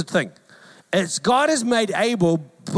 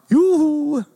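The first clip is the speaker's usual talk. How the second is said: high, loud Yoo-hoo; calling out; 8 semitones higher, 4 dB louder than usual. Acted. Jesus knocking on the porch, calling high. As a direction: hail the house from the porch.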